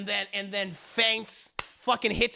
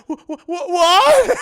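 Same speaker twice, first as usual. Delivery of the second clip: sputter, then yelp it; mock stunned disbelief; 10 semitones higher, 13 dB louder, slower than usual. Husband hears wife's confession, stuttering mock shock. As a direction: stammer rising into a loud, high "what".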